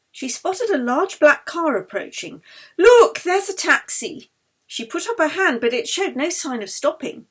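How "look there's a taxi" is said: high, loud, slow call of discovery; excited spotting. Acted. Yeli points out a taxi to Anna, excited and loud. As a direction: point it out, thrilled.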